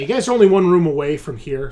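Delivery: ordinary talk, nothing marked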